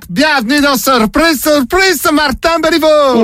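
bad Quebec accent. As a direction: announce the prank in a rotten Quebec accent. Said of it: "mon accent québécois, il est vraiment pourri".

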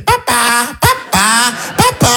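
voix de bambin qui appelle; imitation de tout-petit. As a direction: appeler papa en enfant, de plus en plus aigu. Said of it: "il fait". (petit enfant imité qui appelle son père)

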